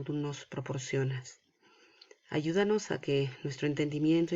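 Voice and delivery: soft, low, reverent prayer voice; hushed prayer before the lesson; opening prayer. Real, not acted. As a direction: pray quietly and evenly, pausing between phrases.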